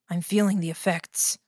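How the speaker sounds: ordinary delivery, nothing marked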